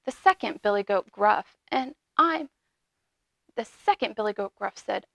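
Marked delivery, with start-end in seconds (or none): none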